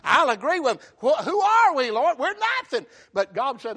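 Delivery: loud, driving sermon delivery; full-voiced pulpit fervor; impassioned preaching. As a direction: preach it hard, climbing in pitch to a peak.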